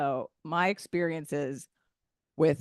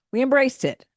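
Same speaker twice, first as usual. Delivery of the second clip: more eager emphasis; 7 semitones higher, 6 dB louder than usual. Real, not acted. Repeated for emphasis.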